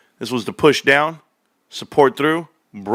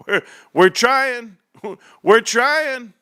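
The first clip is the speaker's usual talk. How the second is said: laughing reassurance; 10 semitones higher, much slower than usual. Real, not acted.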